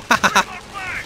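a short high giggle; quick gleeful giggle; high laugh. Real, not acted. Player laughs at his taunt, high giggle.

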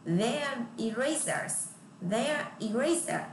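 slow "Their" with tongue out on th. Shown as a demonstration. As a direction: stretch "Their erasers" for the class. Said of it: "các bạn phải đưa lưỡi ra". (đọc chậm "Their" lè lưỡi)

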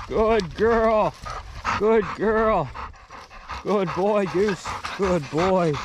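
high-pitched dog praise; squeaky praising a dog. Real, not acted. Praising dogs on return.

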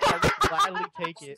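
high, laughing through the words. Real, not acted. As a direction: laugh as you say it. Laughing during chat.

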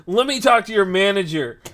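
loud, high mock complaint; demanding customer. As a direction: imitate an irate customer making a demand. Retold customer demands the manager.

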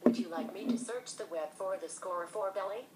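normal talking voice

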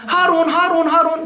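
put-on high, pestering childlike call; Bahlul. The madman pesters the caliph for attention, playing the fool. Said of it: "Bahlul, der ist so".

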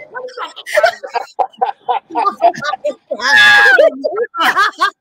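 high, shouted, crying laughter; loud wheezing laugh. Real, not acted. Cracking up at his own pedicure story, loud laugh. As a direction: lose it completely laughing.

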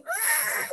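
high frightened yelp; mock scream as the car swerves. Girlfriend in car on mountain road, scared yelp.

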